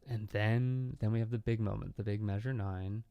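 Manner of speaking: normal talking voice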